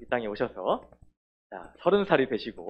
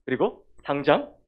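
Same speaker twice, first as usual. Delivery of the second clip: commanding; 3 semitones higher, 9 dB louder than usual. Acted. Jesus rebuking the demon in the synagogue, loud command. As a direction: order the demon out with full force. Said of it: "명령을 하셨어".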